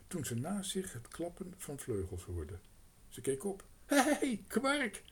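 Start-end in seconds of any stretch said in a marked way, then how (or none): 3.9-5.0 much higher and louder, a glad call across the grass; bright girl's happy shout. A narrator's reading. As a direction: call the crow from afar. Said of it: "riep ze".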